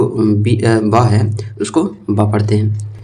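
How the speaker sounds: ordinary delivery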